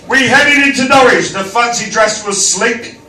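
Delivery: reciting rhyming verse; poem recital. Acted. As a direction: read it like a poem.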